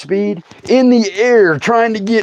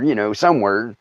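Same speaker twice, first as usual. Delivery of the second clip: usual speaking voice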